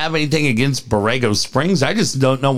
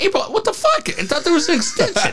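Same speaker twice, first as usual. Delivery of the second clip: high, fast startled cry of disbelief; shocked outburst; 13 semitones higher, faster than usual. Retold or imitated. Host reacts to the forgotten tax deadline, high shocked squeal. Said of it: "I'm like".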